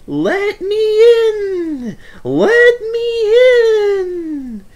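high, eerie ghost voice; Percy playing a ghost outside the shed, spooky wail. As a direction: moan it like a ghost at the door. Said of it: "said Percy in a spooky voice".

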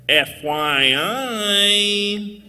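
drawn out very slowly. Acted. Spelled out for the crowd.

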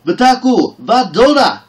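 high, loud desperate cry; a warrior maid's frantic high shout. Acted. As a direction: scream the names as she hits the ground. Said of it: "crying out".

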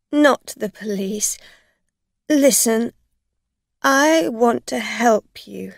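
loud, high desperate pleading; a frightened drunk woman begging; pleading driver. A narrator's reading. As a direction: beg her not to call the police.

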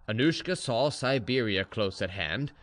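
usual speaking voice

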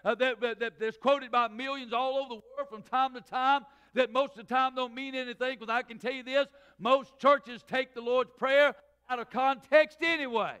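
loud, rising preacher's cadence; full-voiced sermon delivery; preaching at full force. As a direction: drive it home to the pews. Preaching to congregation.

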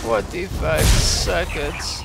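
excited. Real, not acted. Racing against the clock.

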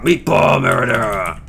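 slow drawn-out mock yell; mock shout. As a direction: stretch it out like a wrestling announcer. Hammed-up shout.

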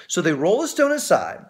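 usual speaking voice

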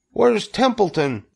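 high-pitched, urgent piglet; urgent demand. A narrator's reading. Wilbur hunts for the rat, urgent demand. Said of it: "he demanded".

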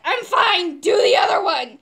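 loud, high, urgent order; pained shout. Retold or imitated. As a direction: yell it to get it over with. Acts snapping at doctor in pain.

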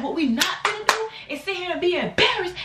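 high put-on scolding voice; going off. Speaker scolding her friend in the club, high and scolding.

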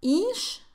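"ish" as a high-pitched eesh; mock the mistake with a high, long ee in "ish"; high, tense eesh for "ish"; wrong tense vowel. Shown as a demonstration. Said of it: "no. Escucha".

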